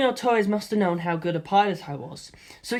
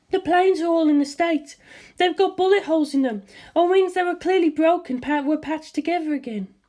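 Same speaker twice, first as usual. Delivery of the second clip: higher, puzzled mother; 11 semitones higher than usual. A narrator's reading. Mother on the phone asks her son what is going on, puzzled. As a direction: voice the mother, confused and cheerful.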